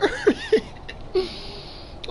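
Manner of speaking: shrill breathy burst of laughter; high squeaky laugh. Real, not acted. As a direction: giggle wildly in panic. Wild laugh mid-panic.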